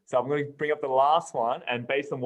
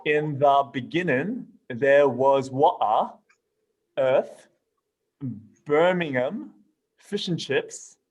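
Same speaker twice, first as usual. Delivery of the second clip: mock British accent; slower than usual. Reading a British meme to friends in a mock British accent. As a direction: lay on a stiff British accent for laughs. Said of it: "I'll try, I'll try it".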